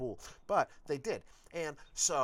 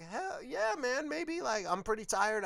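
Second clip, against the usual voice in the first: much higher, bright put-on eagerness; polite forced enthusiasm, pitched way up; fake enthusiasm; 11 semitones higher than usual. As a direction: cover the no with a cheery, sky-high yes. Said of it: "on the outside".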